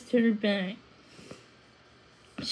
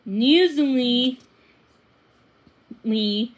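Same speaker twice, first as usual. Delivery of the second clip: ordinary delivery, nothing marked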